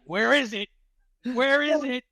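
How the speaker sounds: high eager searching cries; hound dog sniffing. Retold or imitated. Hunting for the weed bag, high eager sniffing calls. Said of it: "I'm like a fucking hound dog".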